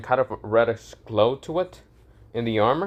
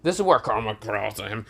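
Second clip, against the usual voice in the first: usual speaking voice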